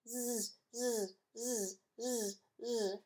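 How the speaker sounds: soft drawn-out zzz snores; snoring. Acted. Amy snoring in bed, soft zzz snores. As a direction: snore softly, child fast asleep. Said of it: "And Amy snored".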